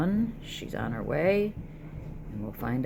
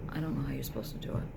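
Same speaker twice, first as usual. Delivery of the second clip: ordinary talk, nothing marked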